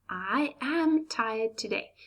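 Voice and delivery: "I am tired today" said slowly with "am" stressed; formal, careful. Shown as a demonstration. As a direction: spell out "I am" slowly and correctly. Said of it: "if we're talking casually".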